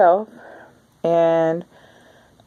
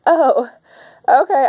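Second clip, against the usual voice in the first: meek okay; 8 semitones higher, 4 dB louder than usual. Retold or imitated. Imitating her meek reply.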